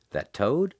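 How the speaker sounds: high, rising disbelief on toad; incredulous. A narrator's reading. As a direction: ask it like you can't believe it. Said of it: "Blackie was incredulous".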